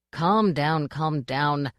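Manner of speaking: usual speaking voice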